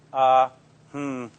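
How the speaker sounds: drawn-out hesitation noises; filler sounds. Shown as a demonstration. Lecturer showing how people fill silence, demonstrated hesitation. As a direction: imitate people stalling between words. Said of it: "you hear".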